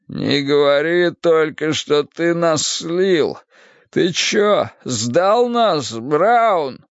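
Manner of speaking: slow, high, pained questioning; drawn-out, distressed reproach; anxious accusation. Acted. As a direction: accuse a partner of betrayal, dreading the answer. Poisoned man accuses his partner.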